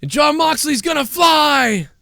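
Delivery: high, drawn-out commentator scream; mock an over-excited play-by-play call; Michael Cole announcer yell. Imitating the commentator's big dive call, high slow excited yell. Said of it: "that's what Michael Cole would say".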